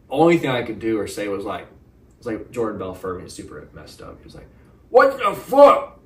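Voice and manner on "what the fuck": high, slow, loud startled yell; shocked yell. Retold or imitated. To the intruder at his bed, a shocked yell. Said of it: "I started yelling at him".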